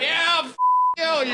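high-pitched mock yell; imitated heckler's outburst. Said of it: "he said it so great".

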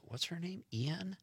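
puzzled friend. Retold or imitated. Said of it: "and he goes".

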